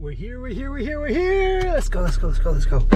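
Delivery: high, giddy chanting; excited. Real, not acted. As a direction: bounce with arrival glee. Arriving excited at Capitol Reef.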